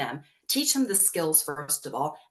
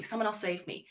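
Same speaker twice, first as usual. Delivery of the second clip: softer, giving up on the call for help; fading plea; 6 dB quieter than usual. Retold or imitated. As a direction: let the plea die away quietly. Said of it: "so quickly look externally for help".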